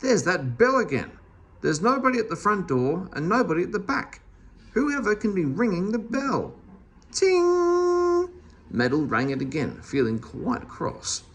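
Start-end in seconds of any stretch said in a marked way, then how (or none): 0.0-6.6 higher, puzzled and put out; a mildly voiced vexed woman; exasperated exclaim. A narrator's reading. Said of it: "a voice exclaiming downstairs". 7.1-8.3 high, ringing ting held long; a high bell chime. Acted. Bell sound imitated.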